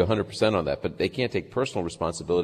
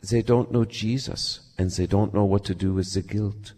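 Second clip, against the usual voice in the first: put-on German accent. German girl telling of young people's guilt, German accent. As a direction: imitate her gently accented English, German-tinged. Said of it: "do her little German accent".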